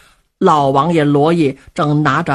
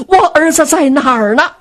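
put-on anxious old mother; 9 semitones higher, 4 dB louder than usual.

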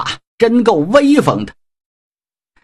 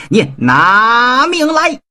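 furious battle cry; 7 semitones higher, slower than usual. Acted. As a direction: drag out the threat as he charges. Avenging prince charges Jiang Ziya. (拉长声调的愤怒怒吼)

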